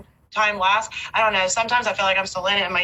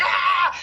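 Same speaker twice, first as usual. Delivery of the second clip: a short, higher, mock yelp of panic; 9 semitones higher than usual. Mother recalling her overprotective fear, mock panicked yelp. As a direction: squeal like a scared helicopter mom. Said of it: "I was just like".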